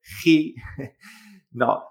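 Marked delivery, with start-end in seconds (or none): 0.0-0.6 "ji" with a throaty Spanish J; the wrong, Spanish-style reading of "ji"; Spanish jota. Shown as a demonstration. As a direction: say "ji" as Spanish "hee" with friction. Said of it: "no es como la J española".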